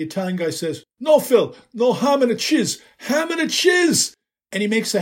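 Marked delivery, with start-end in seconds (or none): 1.0-2.8 put-on louder, higher Italian accent correcting him. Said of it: "an accent you could pour over a plate of spaghetti". 3.0-4.1 put-on much higher, slow, louder Italian accent on ham and a cheese; emphatic Italian accent. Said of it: "an accent you could pour over a plate of spaghetti".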